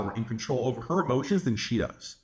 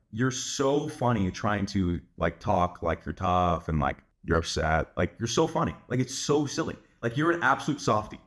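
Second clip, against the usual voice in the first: lightly mocking, pitched-down banter; playful banter; 7 semitones lower than usual. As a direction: tease them in a lowered, easy voice. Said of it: "like, banter things too".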